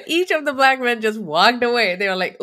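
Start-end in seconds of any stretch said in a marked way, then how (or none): none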